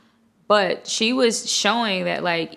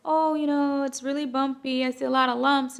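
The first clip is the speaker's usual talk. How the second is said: higher-pitched, tactful retold complaint; polite complaint; 6 semitones higher than usual. Client telling nail tech about the lumps, politely hesitant. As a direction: gently retell a hesitant complaint.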